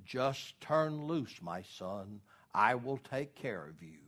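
slow, solemn voice of God; the Lord. Retold or imitated. God tells the man to let go, slow and calm. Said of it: "And the Lord said".

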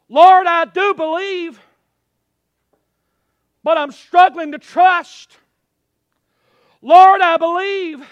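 high, loud cry of struggling faith; shouted prayer. Acted. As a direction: shout it up to God, pausing between phrases. Preacher shouts the repeated prayer.

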